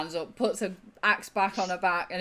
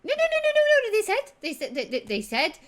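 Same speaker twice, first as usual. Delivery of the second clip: high, loud, stammering insistence; frantic protest; 14 semitones higher, 7 dB louder, faster than usual. Acted. Tyne insisting to Meg they were invited, high frantic protest. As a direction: tumble over the words to object.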